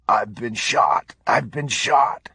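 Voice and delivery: slow, strained gasp, rising on the repeat; gasped cry. A narrator's reading. Wounded officer calling on his radio, slow acted gasping. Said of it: "blood gurgling in Patrick's mouth as he gasped".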